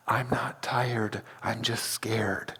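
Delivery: unvoiced retold whisper, slow and soft. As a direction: breathe it like a scared kid's secret. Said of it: "whispered in my ear".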